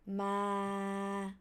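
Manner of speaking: long level "ma" in mid pitch; flat mid tone. Shown as a demonstration. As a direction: hold "ma" straight, no movement. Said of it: "with your mid pitch, go straight".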